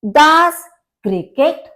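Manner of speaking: usual speaking voice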